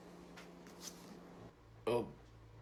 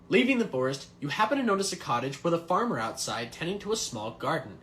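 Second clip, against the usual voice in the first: ordinary talk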